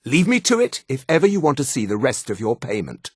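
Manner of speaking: ordinary delivery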